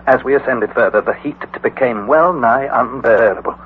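usual speaking voice

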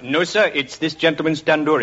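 put-on waiter with an Indian accent. Waiter correcting a customer, put-on Indian accent. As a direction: answer politely in an Indian accent. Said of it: "in an Indian restaurant".

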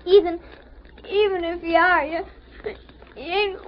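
put-on tearful boy holding back sobs; halting words that give way to crying. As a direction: choke out the words through tears. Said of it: "Come now, if you cry".

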